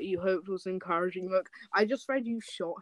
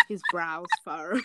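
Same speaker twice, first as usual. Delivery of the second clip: ordinary talk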